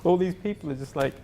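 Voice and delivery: mock-panicked pleading to a colleague; wide-eyed alarm. Asking the facilitator what now, high wide-eyed laughing alarm. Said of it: "looking at him with my eyes wide going".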